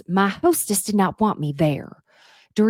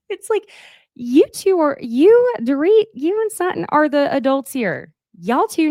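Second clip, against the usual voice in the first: much higher, louder exasperated outcry; exasperated outburst; 11 semitones higher, 6 dB louder than usual. Real, not acted. Scolding the cast on camera, exasperated and loud. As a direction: throw up your hands at them.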